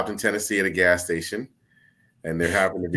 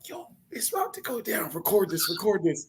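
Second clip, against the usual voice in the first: excited friends; 12 semitones higher, 5 dB quieter than usual. Retold or imitated. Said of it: "me and my boy Pat are like".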